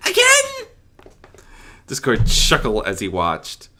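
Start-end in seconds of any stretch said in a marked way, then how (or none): 0.1-0.7 very high, loud exasperated squeak; theatrical exasperation. Acted. Belle watching the fillies tussle, loud and exasperated. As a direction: roll your eyes at the fight. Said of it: "Belle sighed theatrically".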